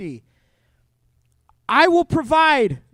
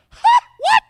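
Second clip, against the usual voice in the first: high shrieked huh, way up and loud; shocked yelp; 10 dB louder than usual. Retold or imitated. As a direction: squeal like you can't believe it. Noah freaking out at God.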